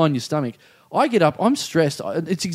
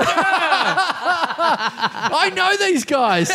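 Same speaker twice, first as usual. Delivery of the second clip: much higher, very slow, through laughter; laughing high; 13 semitones higher, much slower than usual. Real, not acted. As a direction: squeak it out while cracking up. Laughing high-pitched aside.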